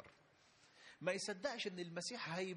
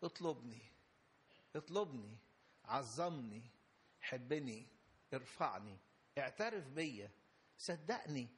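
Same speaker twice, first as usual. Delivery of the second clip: voice of God; 6 semitones lower than usual. Acted. Preacher voicing God's call to his people, low and grave. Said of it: "يقول لك".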